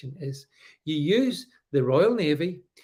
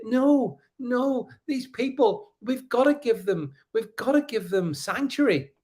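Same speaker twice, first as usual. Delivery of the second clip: mocking lefties; 6 semitones higher than usual. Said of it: "and they say".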